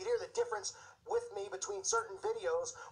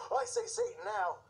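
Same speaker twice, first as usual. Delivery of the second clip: mocking a flippant fan; 11 semitones higher than usual. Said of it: "You might be saying".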